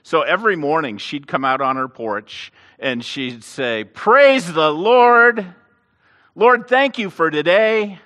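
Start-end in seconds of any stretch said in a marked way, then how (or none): 3.8-8.0 high, loud, slow and drawn-out praise. Retold or imitated. Old lady on her porch praising God out loud, slowly. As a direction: sing it out to the morning like a joyful old lady. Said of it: "praising him in a loud voice".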